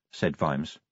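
ordinary delivery

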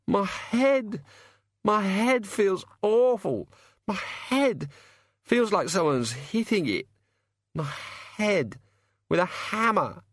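high, slow groaning complaint; pained moaning. A narrator's reading. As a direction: whine through a pounding headache.

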